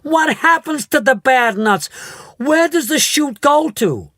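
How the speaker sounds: high, loud hysterical questions; frantic mother. A narrator's reading. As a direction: panic for her daughter.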